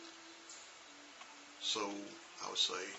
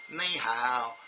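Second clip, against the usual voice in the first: 1 American-style ni hao; 2 semitones higher, 7 dB louder than usual. Shown as a demonstration. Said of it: "but they don't say it like that".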